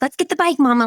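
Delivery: put-on high, eager toddler voice; imitated child's excitement, pitched right up; excited little daughter. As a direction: squeal it lightly like an excited kid. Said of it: "already excited".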